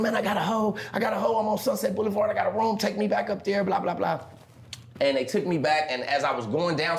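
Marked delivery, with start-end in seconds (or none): 0.0-4.2 higher, eager bragging to friends; bragging excited. Retold or imitated. Telling his square friends about his hoe, excited brag. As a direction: show off like a proud kid. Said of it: "and I said".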